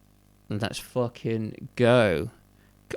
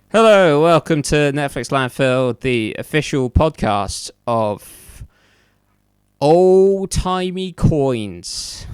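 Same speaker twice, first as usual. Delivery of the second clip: loud, high announcer opening, stretching out the title; big show-opening announcement, drawn out at the end; podcast host intro; 4 semitones higher, 8 dB louder, slower than usual. Real, not acted. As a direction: belt out the show's welcome.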